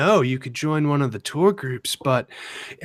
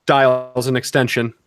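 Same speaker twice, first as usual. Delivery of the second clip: ordinary talk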